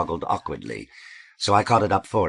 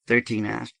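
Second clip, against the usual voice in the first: ordinary delivery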